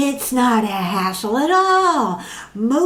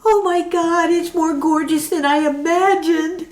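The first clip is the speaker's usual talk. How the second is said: gushing; 9 semitones higher than usual. Acted. Sylvia gushing over apartment.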